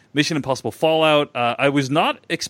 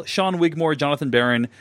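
ordinary delivery

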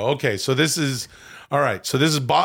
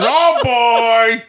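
high, loud hammy holler; Robert De Niro doing Al Pacino; 8 semitones higher, 6 dB louder than usual. Acted. De Niro quoting his friend Al, loud mock Pacino holler. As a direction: belt out a drawn-out actor impression. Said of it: "Al Pacino says".

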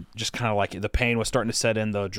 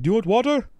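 mimicked helper; 10 semitones higher, 4 dB louder, faster than usual.

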